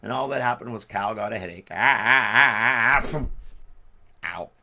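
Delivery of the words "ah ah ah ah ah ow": loud hammed-up groans of pain; mock pained moans. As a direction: groan like a cartoon cow with a headache. Cow got headache.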